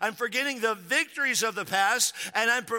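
preaching at full force. Real, not acted. Pastor to congregation, preaching at full force. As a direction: drive it home to the congregation.

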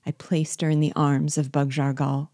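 usual speaking voice

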